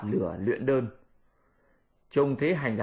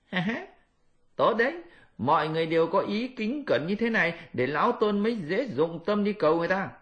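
high laughing monkey voice; high cheerful Monkey King; Monkey King pleased; 6 semitones higher than usual. Acted. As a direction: approve the reverent mourners.

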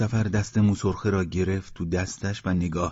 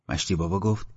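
usual speaking voice

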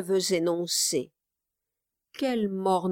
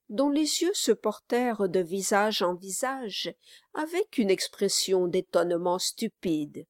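ordinary delivery

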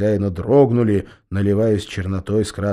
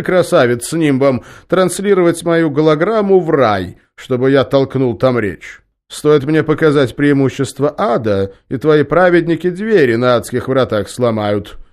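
louder, higher mocking devil; devil boss voice; 6 semitones higher, 7 dB louder than usual. A narrator's reading. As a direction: boom it out like a smug demon chief.

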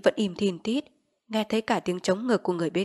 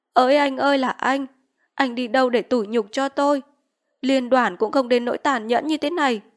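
lightly wailed lament, pitch raised; wailing lament; 5 semitones higher, 7 dB louder than usual. Acted. Vít lamenting her misery to the crowd, mild wailing. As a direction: cry out your misery to heaven. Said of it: "Chị vẫn bù lu bù loa".

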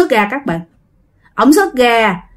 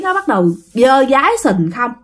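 ordinary talk, nothing marked

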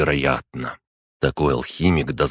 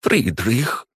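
high, sharply rising exclamation of a name; chilly, startled reproach; icy tone; 7 semitones higher than usual. A narrator's reading. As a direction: call out the name in cold indignation. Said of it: "тон Фламеля заледенел".

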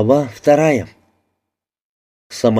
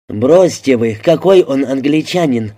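ordinary delivery

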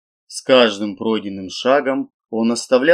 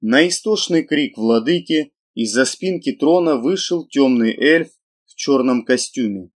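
plain reading voice

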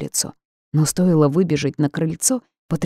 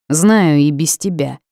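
louder, slower, grumpy snap; snapping back; 6 dB louder than usual. A narrator's reading. Old ghost snaps back at Daler, louder and grumpy. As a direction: bite back at the bossy man. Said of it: "тут же огрызнулся старик".

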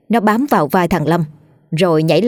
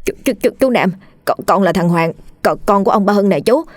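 trembling voice; 3 semitones higher than usual. Acted. Young man stammers to the neighbor after colliding, trembling voice. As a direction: stutter it out, shaken and scared. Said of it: "trả lời bằng giọng run rẩy".

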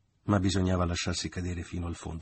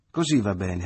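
louder cheerful approval; applauding; 8 dB louder than usual. A narrator's reading. Mother applauding the hug, louder and cheerful. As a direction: clap the reconciliation. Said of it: "applaudì la madre".

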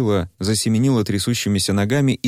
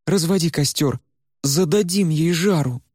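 much higher, sly, eager order; Варя scheming; 9 semitones higher than usual. Acted. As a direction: relish the plan. Varya plots revenge. (высокий, азартный голос Вари)

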